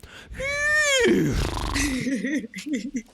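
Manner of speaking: high neighing horse sound; whinny like a horse, laughing through it. Shown as a demonstration. Whinnying horse noise to show his podcast co-host. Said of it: "let me try one more time".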